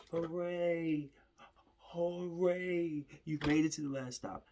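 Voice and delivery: high, loud triumphant cheer; mock cheer. Finally at the last stop after a bad day, cheering loudly. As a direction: celebrate like you won a prize.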